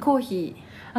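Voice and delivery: coffee in Hokkaido intonation; Hokkaido accent. Shown as a demonstration. Naming a word that betrays her dialect, Hokkaido accent. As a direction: slip into a Hokkaido accent on コーヒー. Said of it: "方言が出ちゃうから".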